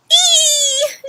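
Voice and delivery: very high, loud scared shriek. Acted. Flap shrieks falling in the dark. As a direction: shriek in terror. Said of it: "shrieked Flap".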